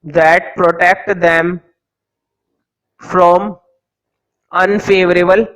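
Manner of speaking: each word spaced out slowly for note-taking; teacher dictating notes to the class slowly; slow dictation. Acted. Teacher to students copying notes, slow dictation.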